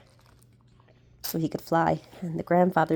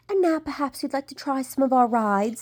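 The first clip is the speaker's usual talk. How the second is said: queen's voice; 7 semitones higher than usual. A narrator's reading. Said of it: "said the queen".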